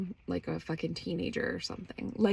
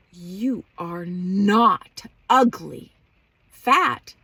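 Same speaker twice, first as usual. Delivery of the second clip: slow, drawn-out, loud imitation of mom; emphatic mock reassurance; 8 dB louder, much slower than usual. Mom reassuring her daughter she isn't ugly, exaggerated and slow. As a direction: stretch each word with exaggerated emphasis. Mother's exaggerated reaction, retold.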